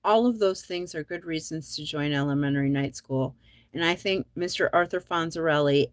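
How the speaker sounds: usual speaking voice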